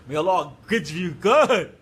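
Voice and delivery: slow, loud, breaking into laughter; amused blessing. Retold or imitated. Preacher mocking a kind sign-off on a negative message, amused and loud. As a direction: draw it out loudly, tipping into a laugh.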